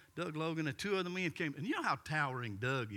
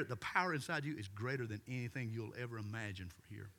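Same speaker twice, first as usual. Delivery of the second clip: normal talking voice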